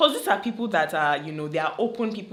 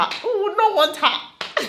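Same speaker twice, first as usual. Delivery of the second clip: laughing admiration; 13 semitones higher, 3 dB louder, slower than usual. Real, not acted. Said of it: "I'm talking like I want her to".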